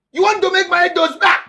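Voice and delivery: high, fast furious scolding; angry yelling. Acted. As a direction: shout him down in rage. Repeats angry accusation.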